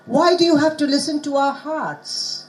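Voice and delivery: Why do you have to listen to our hearts, with hearts stressed. Shown as a demonstration. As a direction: land the weight on hearts. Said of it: "here you will stress the heart".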